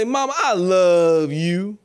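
loud, slow, sing-song Mom I love you; mock tender. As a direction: ham up a sweet hug to mom. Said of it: "acting hugging mother".

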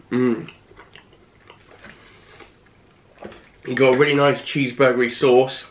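speaking with food in mouth; mumbling between bites; talking while chewing. Real, not acted. Seen 0: Bite at -2.0; cheeks and jaw working while speaking after.

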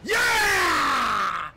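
long, loud victory yell; triumphant shout. Real, not acted. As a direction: bellow it out in triumph. Wins the game round.

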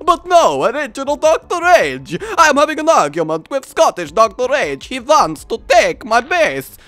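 high, loud Transylvanian whine; Transylvanian vampire. Acted. Vampire complains about Scottish rival, loud Transylvanian accent. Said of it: "I'm having a free accented conversation".

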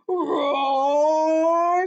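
long, loud swelling roar; dinosaur roar. Acted. Dinosaur chases the fleeing readers, loud roar. The dinosaur roars behind them.

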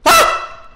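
short, very high shriek; startled scream. Real, not acted. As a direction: scream at a sudden jump scare. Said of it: "I'm already screaming".

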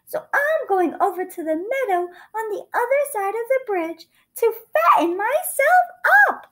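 tiny high-pitched little goat; small, high, innocent goat voice. A narrator's reading. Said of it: "in a very small voice".

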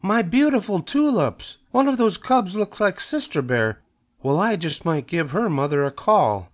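high-pitched indignant neighbor; wail, then grumble a threat, easing down; dismayed cry. A narrator's reading. Neighbor spots the culprit from her window, indignant. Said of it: "she cried".

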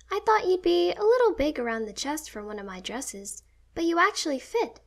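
high, loud, surprised sister voice; Phoebe. A narrator's reading. Sister judging the dress's fit, high-pitched and animated. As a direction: lift the voice high for the sister's dialogue.

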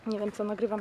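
ordinary talk

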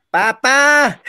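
high call for dad across the stage; shouted "Papá!" from the wings. Retold or imitated. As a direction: call out to your dad, kid-like. Child calling dad on stage.

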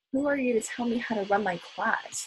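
ordinary delivery, nothing marked

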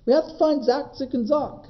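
rally the dog to search; calling urgently. Acted. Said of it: "he calls".

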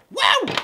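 high, loud cheer; excited whoop. Real, not acted. Host celebrating the October Toys haul, loud whoop. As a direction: let out a big celebratory woo.

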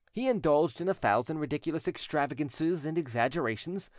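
ordinary delivery, nothing marked

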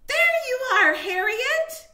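high, loud delighted squeal. A narrator's reading. Child finding the lost pet hamster, delighted squeal. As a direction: squeal with joy. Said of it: "a delighted voice squealed".

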